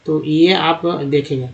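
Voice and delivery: ordinary delivery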